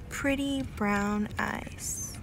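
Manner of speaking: whispering. Real, not acted. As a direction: whisper softly to the dog. Cooing at the dog.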